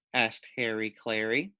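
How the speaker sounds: ordinary delivery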